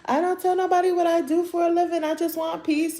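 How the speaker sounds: imitating her. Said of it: "she was like".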